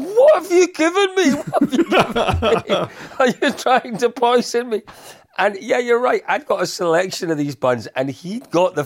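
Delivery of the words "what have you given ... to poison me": mock outrage. Friend's outraged question, retold laughing.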